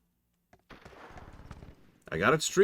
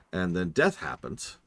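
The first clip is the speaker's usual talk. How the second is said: ordinary talk, nothing marked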